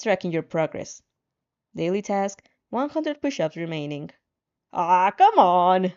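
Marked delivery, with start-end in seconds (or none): 4.7-5.9 slow, louder exasperated groan of a line; exasperated exclaim. Acted. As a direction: drag it out in frustration. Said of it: "you exclaim".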